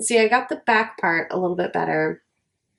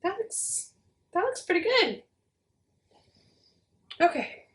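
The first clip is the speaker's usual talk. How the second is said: amazed hush; 10 semitones higher, 7 dB quieter than usual. Real, not acted. Amazed the glue worked.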